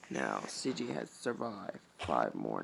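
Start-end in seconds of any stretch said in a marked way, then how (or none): none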